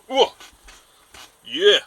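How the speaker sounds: loud, high burst of triumph; triumphant catch. Acted. Fisherman landing his trout with the net, triumphant exclamation. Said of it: "and he just".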